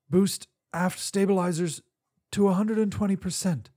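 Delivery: commanding. Acted. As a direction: bark out a captain's command. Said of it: "Roberts ordered".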